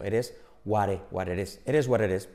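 "it is" said like Spanish "eres"; tapped-T linking. Shown as a demonstration. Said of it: "Eres, como eres rápido".

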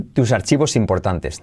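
ordinary delivery

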